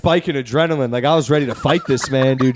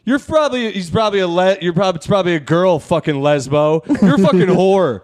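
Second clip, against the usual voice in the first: raised, louder imitation of a rejected suitor; mock angry insults; 5 semitones higher, 5 dB louder than usual. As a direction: lash out like a spurned catcaller.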